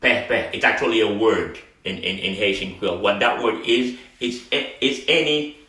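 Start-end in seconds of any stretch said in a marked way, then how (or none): 0.0-0.5 "Pe pe" as peh-peh, open vowels; accented vowel. Shown as a demonstration. Teacher repeats the accented word, "Pe pe" as peh-peh. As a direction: say "Pe pe" with open "eh" in both syllables. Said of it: "with the accent".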